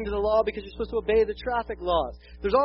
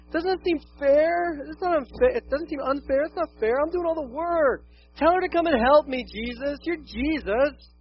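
whiny complaint; 8 semitones higher, 5 dB louder than usual. Retold or imitated. Martha nagging Jesus about Mary, in a high whine. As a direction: whine it like a child wronged. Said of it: "that direct quote has been spoken in our home".

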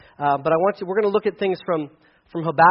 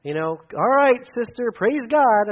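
mock preachy platitude; 7 semitones higher, 3 dB louder, slower than usual. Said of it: "this isn't just a platitude".

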